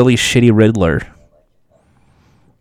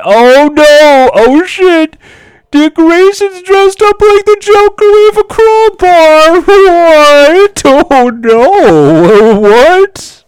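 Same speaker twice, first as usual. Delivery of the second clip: much higher, much louder cackling panic; manic Joker shrieking; 8 dB louder, much slower than usual. Acted. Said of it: "Joker starts cracking it".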